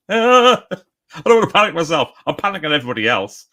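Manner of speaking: high, loud squeal tumbling into hammed-up panic; mock panic. Writer behind schedule joking about panicking everybody, mock panic. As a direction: play at panicking, shrill start, easing off.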